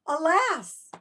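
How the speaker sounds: high, loud gasped Alas; gasp of a great idea. Shown as a demonstration. Teacher shows children the sudden idea, high loud gasped Alas. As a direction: gasp then cry out Alas as the idea hits. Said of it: "Can you do that?".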